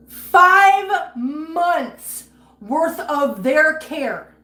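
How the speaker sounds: loud, tearful outrage; angry rant. Real, not acted. Streamer furious at viewers about pet fees, loud and tearful. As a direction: pitch up, voice cracking with anger. Ranting about losing money for cats.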